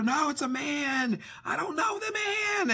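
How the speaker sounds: frightened scream. Retold or imitated. Half-asleep son screams about a stranger, rising high shout. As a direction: yell it out, building to panic. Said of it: "he's screaming about a man".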